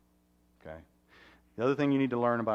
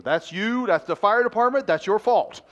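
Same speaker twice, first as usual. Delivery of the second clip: much louder, higher mock accusation from the daughter; accusing daughter; 10 semitones higher, 11 dB louder than usual. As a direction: point the finger and blame him, loud and high. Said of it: "she points at me and goes".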